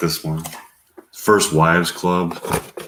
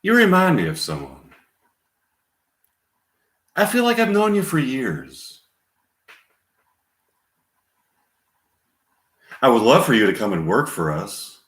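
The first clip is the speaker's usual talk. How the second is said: Sally Field; 4 semitones higher than usual. Acted. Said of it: "Scene one, Sally Field questioning".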